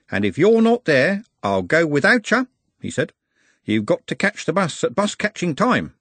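a worldly driver amused; lightly voiced jovial driver; chatty bus driver. A narrator's reading. Driver winks at Glenda.

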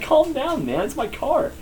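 high, strained mock plea; exasperated plea. Owner retells pleading with the friend doing donuts, high and exasperated. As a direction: beg him to stop spinning your car. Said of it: "And I was like".

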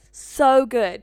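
a big loud burst on so good; emphatic loud repeat. Real, not acted. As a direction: shout it out with delight. Repeating her own exclamation.

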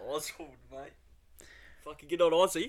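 lightly hammed-up Australian accent; mock Aussie accent. Co-host riffing on Oswald's name, mock Aussie.

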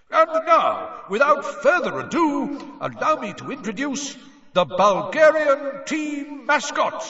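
magically amplified voice. A narrator's reading. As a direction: boom it across a stadium. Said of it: "booming into every corner".

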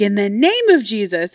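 loud, swooping holy invocation; mock rebuke. Mom trying to rebuke her daughters, mock-preachy invocation. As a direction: mimic a zealous mom's rebuke.

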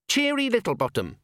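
the recruit says his own name high and earnest; young dwarf voice. Acted. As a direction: say "Cheery Littlebottom" high and eager like a nervous young dwarf. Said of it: "he said".

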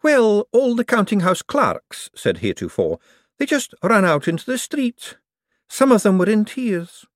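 high, anxious clerk voice; nervous clerk. Acted. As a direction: report it anxiously. Heretofore reports.

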